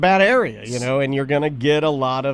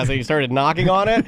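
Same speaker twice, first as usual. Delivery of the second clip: ordinary talk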